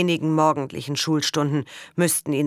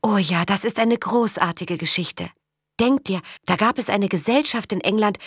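eager excitement; 6 semitones higher than usual. Acted. Girl eagerly telling Karl about Captain Cook's voyage, excited. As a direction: tell it like the best story ever, rushing with delight. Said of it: "In Idas Gesicht ging ein Leuchten auf".

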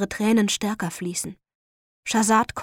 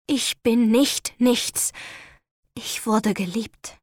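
slow defiance; slower than usual. Acted. Defiant last words, dying.

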